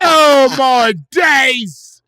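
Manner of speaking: high, loud, very drawn-out British exclamation; hammed-up British astonishment; British accent. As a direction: stretch it out as a mock British reaction guy. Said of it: "Or if they're British".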